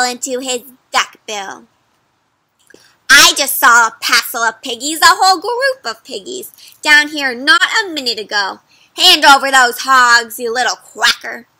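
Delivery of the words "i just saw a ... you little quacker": loud scolding witch; angry witch. Acted. Witch berating the duck over the hogs, loud.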